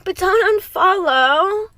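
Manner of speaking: high, desperate, almost sobbing; tearful plea. Real, not acted. Woman begging followers not to unfollow, high and tearful. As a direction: beg in a high, breaking voice.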